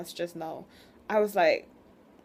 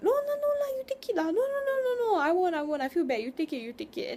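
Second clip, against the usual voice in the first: very high, squeaky hammed-up refusing voice; mock polite refusal; 12 semitones higher, faster than usual. Mocking old relatives refusing out of face, high and squeaky. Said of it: "you know, like".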